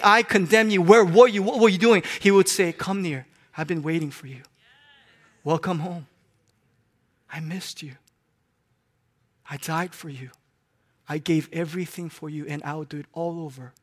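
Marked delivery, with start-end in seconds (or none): 0.0-2.0 loud, raised accusing questions; mock condemnation. Voicing what God didn't say. 2.7-13.7 soft, unhurried, tender welcome; gentle. Acted. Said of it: "wasn't that voice so gentle like that?".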